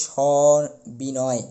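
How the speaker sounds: "সবিনয়" said slowly, opening অ stretched after স; drawn-out first vowel. Shown as a demonstration. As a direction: draw out the অ in the first syllable of "সবিনয়". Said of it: "স দন্ত স্বর পরপরেই কিন্তু একটি অ উচ্চারিত হচ্ছে". ("সবিনয়" শব্দে স-এর পরের অ টেনে ধীরে উচ্চারণ)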